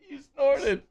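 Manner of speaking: teasing through laughter; laughing at the snort. Real, not acted. Laughing tease.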